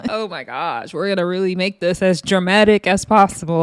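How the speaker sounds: usual speaking voice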